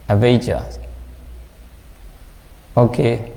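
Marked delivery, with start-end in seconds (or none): 0.1-0.8 "Avijja" as ah-VAY-jah, v and j; foreigners' pronunciation. Shown as a demonstration. Teacher repeating the foreign form, international pronunciation of "Avijja". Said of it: "they pronounce".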